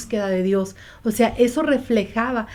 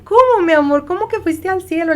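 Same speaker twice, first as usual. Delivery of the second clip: higher, half-laughing disbelief; retold astonished question, higher and laughing; stunned mother; 7 semitones higher than usual. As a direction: ask in startled disbelief with a laugh.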